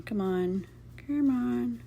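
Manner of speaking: ordinary talk, nothing marked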